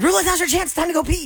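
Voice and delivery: high-pitched excited announcement; mock urgent prompt. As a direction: quote someone calling out at a high pitch. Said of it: "that's why somebody said".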